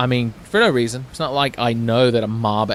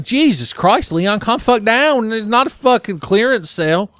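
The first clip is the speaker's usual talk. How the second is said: much higher, louder exasperated yell; exasperated outburst; 9 semitones higher, 6 dB louder than usual. Real, not acted. Player yelling at Leon to stop selling everything, much higher, louder. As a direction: yell at him to calm down.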